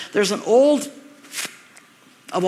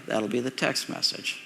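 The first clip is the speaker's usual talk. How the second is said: ordinary delivery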